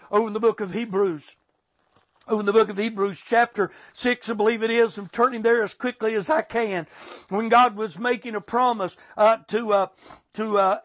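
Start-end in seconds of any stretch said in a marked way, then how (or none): none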